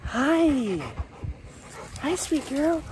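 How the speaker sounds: high cooing pet voice; talking to a dog. Real, not acted. Greeting the dog.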